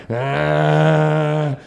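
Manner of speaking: long zombie moan. Retold or imitated. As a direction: moan like the undead reaching in. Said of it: "just like a zombie".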